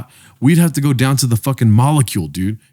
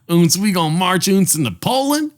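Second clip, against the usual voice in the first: higher, hammed-up German-Southern accent; a goofy German-Southern accent, pitched up; 7 semitones higher than usual. Mixing German and Southern accents as a joke for a friend, higher. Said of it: "mix a German and Southern accent".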